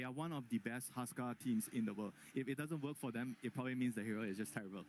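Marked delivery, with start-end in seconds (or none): none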